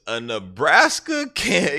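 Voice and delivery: high, loud, drawn-out mock disbelief; incredulous retelling. Interrogator throwing the suspect's claim back at him, incredulous.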